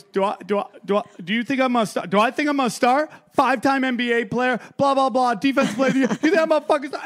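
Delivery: hammed-up blubbering athlete; mock bawling. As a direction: whine it out like a crying star. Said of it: "he is fucking bawling".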